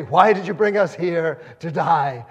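loud, whiny accusing wail; complaining Israelites. Retold or imitated. Israelites accusing Moses of bringing them to die, loud whine.

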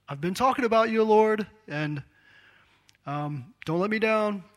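high, loud half-joking plea; anxious pleading prayer. Pretend. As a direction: beg God not to leave you hanging. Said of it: "tempted to be like".

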